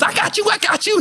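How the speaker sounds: high, nervous mock promise; hammed-up nervous yes-man; mock promoter caving. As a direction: squeak it out like a cowed man.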